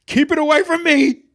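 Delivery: high terrified shriek; panicked high shriek. A narrator's reading. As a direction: scream it out in terror. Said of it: "The last word came out as a shriek".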